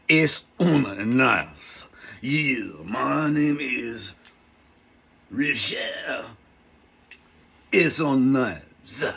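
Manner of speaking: very slow, mock French-German accent; badly faked accent, ponderously drawn out; mangled European accent. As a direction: butcher a French accent, stretching every word. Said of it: "I'm really slaughtering all these accents".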